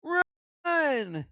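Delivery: high, loud cry urging him to run; urgent shout. Pretend. Player yelling at Larry on screen, high urgent shout. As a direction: yell at Larry to get moving.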